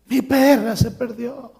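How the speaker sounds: high, tearful child imitation; mock a bawling kid; wailing child. Child wailing to visitors about a lost dog, mock crying. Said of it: "un niño llorando".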